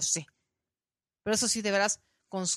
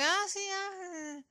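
dismissive reply; 11 semitones higher, 4 dB quieter than usual. Retold or imitated. The lady's reply imitated again.

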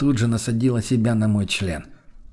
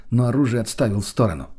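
usual speaking voice

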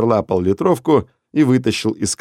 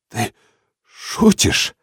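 strained, half-voiced squeeze; forced out; much slower than usual. Stunned Denis squeezes the question out, strained and breathy. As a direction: force the words out of a tight throat. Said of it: "выдавил он".

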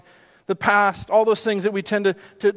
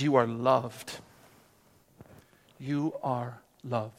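tender, hushed reassurance; 7 semitones lower, 7 dB quieter, slower than usual. Acted. Jesus speaks to you.

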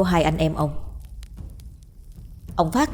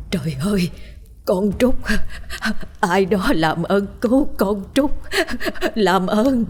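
gào khóc van xin; 3 semitones higher than usual. A narrator's reading. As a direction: plead desperately for help through sobs. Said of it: "Bà cụ Lan gào lên". (gào khóc thảm thiết)

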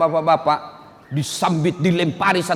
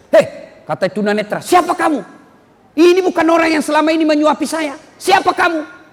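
higher, fast angry demand; angry shout; 9 semitones higher, 4 dB louder than usual. Acted. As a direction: knock the hand away and demand who it is. Blind man pushes away food. (bentakan marah yang tinggi)

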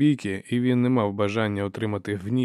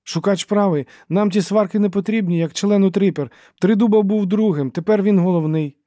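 high, loud, insistent voice; eager peacemaker; 10 semitones higher, 9 dB louder than usual. A narrator's reading. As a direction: back him up in a high, urgent voice. Forley backs Dogman, voiced high. (високий, гучний, наполегливий голос)

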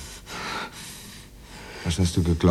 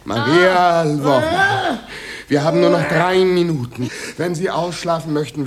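urgent call; 13 semitones higher, 5 dB louder than usual. Acted. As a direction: call her back urgently. Producer urges pianist loudly. (dringlich, laut rufend)

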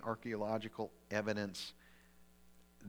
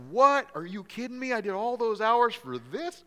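furious, much louder, high-pitched outburst; ticked off; 12 semitones higher, 12 dB louder than usual. Retold or imitated. Mark venting at God over the lost overtime, furious shout. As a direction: blow up in disbelief at being cheated. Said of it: "I was ticked".

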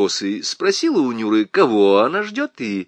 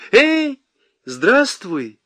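high, loud friendly hail; calling out; 11 semitones higher, 5 dB louder, much slower than usual. A narrator's reading. Nyura hails the lieutenant in the doorway, calling out. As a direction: call out a greeting across the yard. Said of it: "крикнула ему Нюра".

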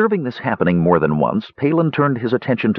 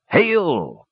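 high, drawn-out hail; grand greeting called out in mild projection; crying out; 7 semitones higher than usual. A narrator's reading. Dugan hails the warriors' chief on the beach, crying out. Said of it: "cried Dugan".

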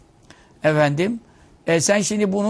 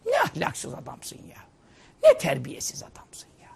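hushed scolding; 4 semitones lower, 4 dB quieter than usual. Acted. Preacher hushing a scolding at the rude husband. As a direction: shake your head at him quietly.

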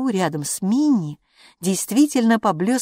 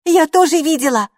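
high, loud excited squeal; excited outcry, up high; 10 semitones higher, 8 dB louder than usual. A narrator's reading. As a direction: squeal it with raised pitch. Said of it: "взвизгнула Хилла".

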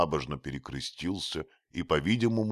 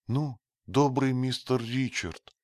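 voiced youth; 11 semitones higher, 6 dB louder than usual. A narrator's reading. Matcham voiced higher.

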